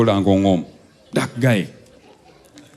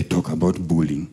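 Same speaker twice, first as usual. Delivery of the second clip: low menacing mutter; 8 semitones lower, 8 dB quieter than usual. Retold or imitated. Dad leans forward and quietly threatens the rich guy, low menacing voice.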